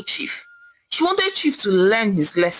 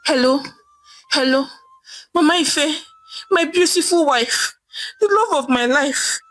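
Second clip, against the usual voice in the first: higher pleading husband on the phone; jailed husband begging his wife on the phone; 6 semitones higher than usual. Acted. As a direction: beg sweetly to be bailed out. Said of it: "he said in his begging voice".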